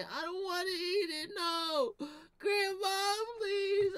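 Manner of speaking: put-on high whiny pleading child voice; mock crying kid. A smarter cousin begging grandma, mock-crying plea. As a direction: beg grandma like a whimpering kid, hammed up. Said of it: "they woulda started crying".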